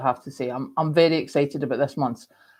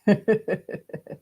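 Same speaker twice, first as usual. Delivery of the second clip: real chuckle; 3 semitones higher than usual. Real, not acted. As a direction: run of short chuckles. Laughs after reading a comment.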